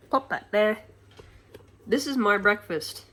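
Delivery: ordinary talk, nothing marked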